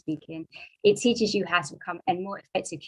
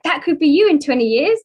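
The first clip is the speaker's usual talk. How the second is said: loud, higher warning from the manager; a manager's loud teasing warning; quoting the manager; 6 semitones higher, 7 dB louder than usual. Retold or imitated. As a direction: point at her future with a raised voice. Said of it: "And he was like".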